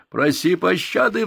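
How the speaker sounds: high, loud playful roar, slow; mock roar. A narrator's reading. Boy pinning his friend, playful roar. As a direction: bellow it like a wrestling champion. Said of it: "взревел я".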